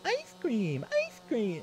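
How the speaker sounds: imitating a tiny daughter, very high squeak; cutesy high toddler voice; little high-pitched toddler. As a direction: squeal it like a small child asking for a treat. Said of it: "it's this h-, little high-pitched".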